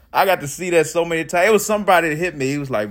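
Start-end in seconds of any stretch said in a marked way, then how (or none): none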